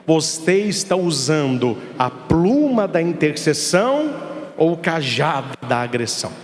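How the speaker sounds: normal talking voice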